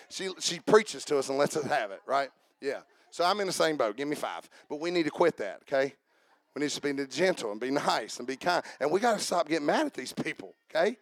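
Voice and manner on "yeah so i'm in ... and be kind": ordinary talk, nothing marked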